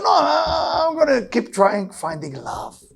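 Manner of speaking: tearful reply. Retold or imitated. Friend answering, near tears.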